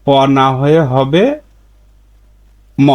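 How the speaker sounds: usual speaking voice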